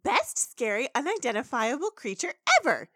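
high, excited exclamation; thrilled pony. Retold or imitated. Pony delighted by a cave creature, high and excited.

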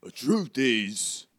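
slow, weighty character voice; Hagrid. Acted. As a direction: begin gravely in a put-on accent.

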